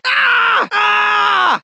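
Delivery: sharp yell of eye pain; pained scream. Acted. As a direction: scream as the burning hits. In pain from burning eyes.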